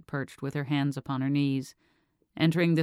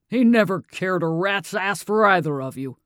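angry outburst; 3 semitones higher, 7 dB louder than usual. A narrator's reading. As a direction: spit it out like a father who has held it in. Father's angry outburst at daughter.

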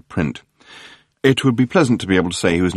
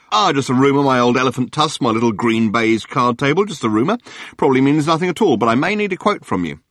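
gushing tabloid hack; 5 semitones higher than usual. Acted. Journalist Murray greeting Arthur on the phone, gushing. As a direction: lay the flattery on thick. Murray voiced, high and chatty.

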